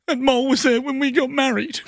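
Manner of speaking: high, wobbly mock-teary voice; imitating wife's weepy sob. Husband imitating wife's mole tears, mock-weepy high voice. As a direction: squeak it out like a tearful wife. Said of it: "She goes".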